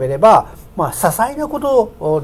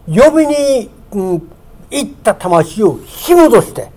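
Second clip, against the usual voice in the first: fervent preaching; 3 semitones higher than usual. Acted. Preacher stressing the power of Jesus' cry, fervent. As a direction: lift the words to stress their power.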